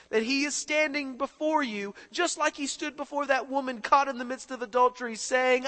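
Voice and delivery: building from plain to loud, high preaching; preacher building to a loud peak on God's love; rising preaching. Acted. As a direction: climb toward the climax.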